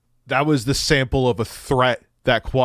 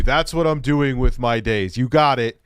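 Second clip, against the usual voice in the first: ordinary talk, nothing marked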